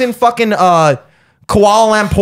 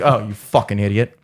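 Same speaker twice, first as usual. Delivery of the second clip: low, softer exasperated aside; muttered insult; 10 semitones lower, 4 dB quieter than usual. Retold or imitated. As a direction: drop the voice for a disgusted aside. Acted aside to the other man.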